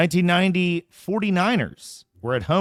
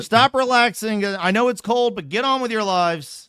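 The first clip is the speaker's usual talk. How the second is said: bossy mock order; 6 semitones higher than usual. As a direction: nag like an impatient boss. Mock society ordering people back.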